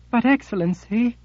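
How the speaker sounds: high, frightened plea to her master; trembling voice. Acted. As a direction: quaver with fear, pitched high. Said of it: "in a trembling voice".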